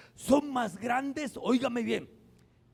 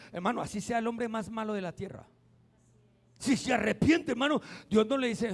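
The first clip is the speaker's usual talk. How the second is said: ordinary talk, nothing marked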